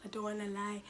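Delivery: usual speaking voice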